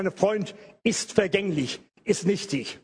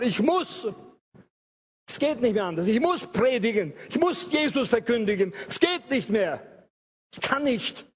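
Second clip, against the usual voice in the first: raised, urgent preacher's confession; fervent preaching; 3 semitones higher than usual. Acted. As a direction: testify it like you cannot help it. (inbrünstig gepredigt, drängend)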